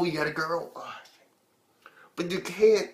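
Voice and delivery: ordinary talk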